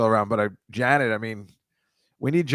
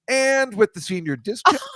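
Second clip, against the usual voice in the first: high, sing-out counter announcement; cashier's announcement; 9 semitones higher than usual. Retold or imitated. Cashier announcing to an unsuspecting customer, brightly mock-official. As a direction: call it out like a cashier ringing up. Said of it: "they just go like".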